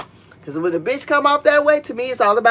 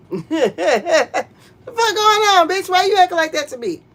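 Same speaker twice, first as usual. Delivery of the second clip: indignant inner rant; 8 semitones higher than usual. Retold or imitated. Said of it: "I'm thinking".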